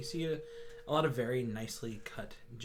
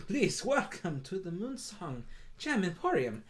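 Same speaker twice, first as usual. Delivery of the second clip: higher, bright-pitched welcoming shop cat; Moon the Tabaxi shopkeeper; 5 semitones higher, 3 dB louder than usual. Acted. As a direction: greet customers grandly in a high voice. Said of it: "gesture and goes".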